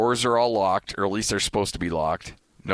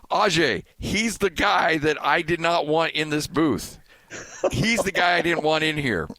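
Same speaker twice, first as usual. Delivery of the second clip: retold yell; 8 semitones higher than usual. Broadcaster scolding his helper in front of Dave, mock yelling. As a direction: re-enact chewing out your helper. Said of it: "I'm yelling at him in front of Dave".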